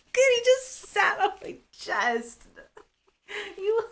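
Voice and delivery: high laughing words. Real, not acted. Mom laughing as her toddler sits on her chest, high. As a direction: talk through the giggles.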